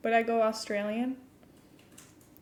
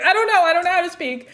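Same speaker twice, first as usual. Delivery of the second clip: fast, loud, high flustered blurt; flustered self-defence, raised and fast; flustered retort; 7 semitones higher, 10 dB louder, faster than usual. Retold or imitated. Retold flustered reply to Kath.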